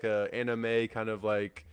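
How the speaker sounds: usual speaking voice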